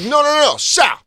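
high, loud insistent yelling; fans calling out. Retold or imitated. Fans calling Sal back from security, yelling. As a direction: holler urgently after someone walking away.

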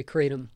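Kratom said as CRAY-tum; long "ay" pronunciation. Shown as a demonstration. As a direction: say Kratom like crate plus "um". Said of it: "How do you say it?".

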